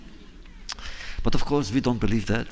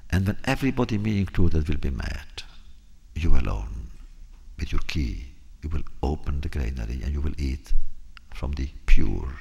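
a solemn lower voice; grave, low king; 6 semitones lower than usual. Acted. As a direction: weigh each word like a last wish. King's grave final request.

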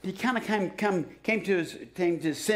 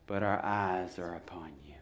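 breathy hushed whisper, softer; whispered prayer; 11 semitones lower, 8 dB quieter than usual. Acted. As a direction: hush it like a secret prayer. Quoting the king's prayer.

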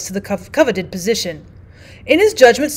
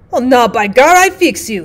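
loud, rising angry threat; Francois grabbing a club, furious; angry cry; 5 dB louder than usual. Acted. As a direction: threaten the dog with real heat. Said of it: "he cried".

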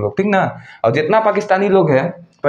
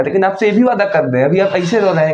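usual speaking voice

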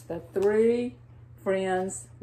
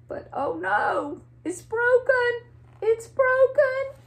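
crying over it. Acted. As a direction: cry out like a heartbroken little bunny. Bunny finds the broken egg.

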